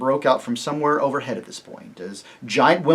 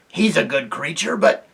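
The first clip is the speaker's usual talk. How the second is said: harsh character voice, slow and insistent; loud, exasperated aside; urgent stage whisper; 3 semitones higher, 5 dB louder, slower than usual. A narrator's reading. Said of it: "whispered Trumpkin to Peter".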